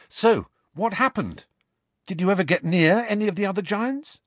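put-on high, quick, insistent boy; Harry prompting. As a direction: steer him back to the story.

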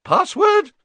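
very high, loud startled demand; sudden high-pitched fluty cry; startled awake. A narrator's reading. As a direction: jolt out of a doze into it. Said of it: "jerking out of a doze".

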